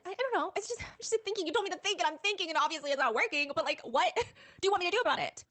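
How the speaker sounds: high-pitched exasperated reply; flustered protest. Retold or imitated. Someone whose idea got shot down, flustered protest. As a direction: act out defending yourself, voice climbing high.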